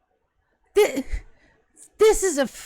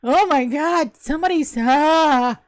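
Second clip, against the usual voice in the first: panicked; 5 semitones higher, 4 dB louder, slower than usual. Acted. Possessed mouth panicking during seizure.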